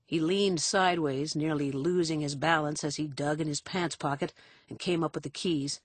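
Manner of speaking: ordinary delivery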